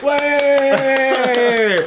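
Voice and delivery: hoch gequiekt wie ein Fan; mock fan squeal. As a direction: spiel den begeisterten Passanten. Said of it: "people would fucking line up and be like".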